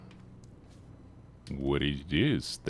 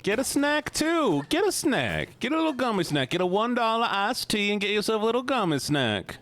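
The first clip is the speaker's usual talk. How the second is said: higher, sing-song coaxing like tempting a pet; playful high wheedling voice; coaxing treat voice; 13 semitones higher than usual. Pretend. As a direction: lure them in with a sweet high tease. Coaxing chat to buy snacks.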